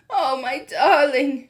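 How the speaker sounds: slow, tender relieved cry; cried with relief. A narrator's reading. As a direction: hold him close in relief. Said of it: "she cried".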